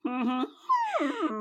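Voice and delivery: loud, high imitation of bad horn playing; dying-elephant horn noise. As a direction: hoot like a badly played French horn. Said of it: "that sounded like a dying elephant".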